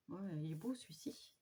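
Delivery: soft whisper, almost to herself; whispered admiration. Real, not acted. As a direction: breathe it out in awe. Admiring a pad quietly. (chuchoté avec admiration)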